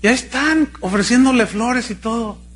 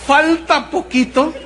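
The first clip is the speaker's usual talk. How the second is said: words squeezed out through real laughter, higher and slow; laughing; 8 semitones higher, slower than usual. Real, not acted. Pastor mocking the excited sisters to his congregation, laughing.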